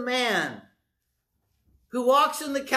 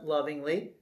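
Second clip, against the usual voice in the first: ordinary talk, nothing marked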